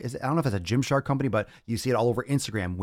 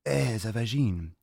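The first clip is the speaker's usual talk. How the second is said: Eh, it's a vagine, in a hammed-up French accent; parodying a French speaker, mock French accent. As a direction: shrug it off like a Frenchman. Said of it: "I love that accent".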